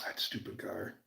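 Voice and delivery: a fond whisper under the breath. Real, not acted. Driver whispering fondly about his old car.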